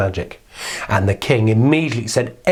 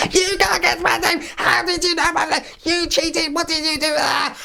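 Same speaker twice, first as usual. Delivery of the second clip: very high, squeaky tantrum; furious little man. Acted. Little man raging at the queen, very high tantrum. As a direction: rage like a stamping imp. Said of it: "the little man was angry".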